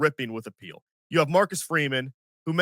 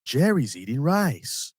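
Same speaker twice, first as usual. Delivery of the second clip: slow, lowered drawl, dragging each word; McConaughey line read; 2 semitones lower, slower than usual. Retold or imitated. Quoting McConaughey's commercial line to listeners, slow drawl. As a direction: savor it like a McConaughey ad. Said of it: "of all the lines in Matthew McConaughey's career".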